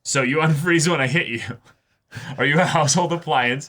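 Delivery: high, loud mock-teasing line with a laugh; teasing character quote. Host quoting the heroine teasing him, playful and laughing. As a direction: read the anime girl's teasing line aloud, laughing.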